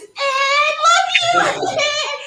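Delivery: high shrieking superfan; hammed-up hysterical adoring yell; Anna. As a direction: scream it from the back of the room. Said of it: "Anna is in the back of this room like this".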